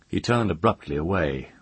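ordinary delivery

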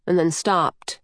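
plain reading voice

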